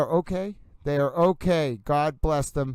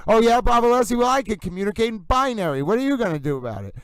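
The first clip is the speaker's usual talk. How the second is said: mock challenge; 6 semitones higher, faster than usual. Playful comeback to a chat rival.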